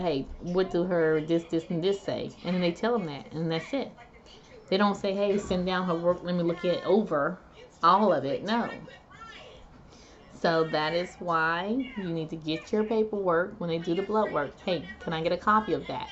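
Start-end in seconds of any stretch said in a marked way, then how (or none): none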